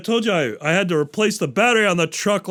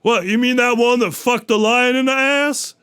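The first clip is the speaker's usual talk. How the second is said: higher, louder mock innocence; innocent gorilla; 8 semitones higher, 4 dB louder than usual. Gorilla disguised as a camper, mock innocent and high. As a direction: play dumb behind the newspaper. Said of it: "the gorilla says".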